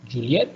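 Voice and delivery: "Juliet" with a sharp upward glide; lone word "Juliet" lifted at the end; rising intonation. Shown as a demonstration. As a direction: turn "Juliet" into a question.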